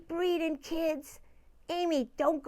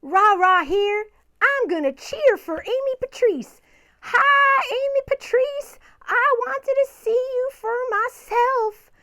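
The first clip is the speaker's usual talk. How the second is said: high, loud cheerleader energy; excited greeting; 8 semitones higher, 10 dB louder than usual. Pretend. Ra Ra greets the kids and cheers for Amy Patrice, loud and excited. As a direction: burst in with a big, high-pitched hello.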